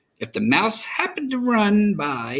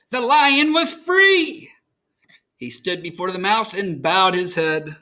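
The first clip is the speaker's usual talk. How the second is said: usual speaking voice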